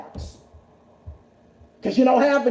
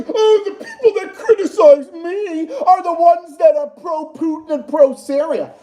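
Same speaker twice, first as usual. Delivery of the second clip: mocking Gilbert; 5 semitones higher, 4 dB louder than usual. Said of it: "And he acts like".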